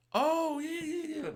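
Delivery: high, put-on voice mimicking her; mocking her voice. Imitating his girl fumbling for an excuse in a mocking voice. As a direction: make fun of her fumbling answer.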